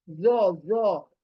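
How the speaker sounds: ज़ो said full-mouthed and heavy, with a rounded mouth; ज़ो with the mouth made big and thick; heavy letter. Shown as a demonstration. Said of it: "मुंह भी अपना मोटा मोटा करो".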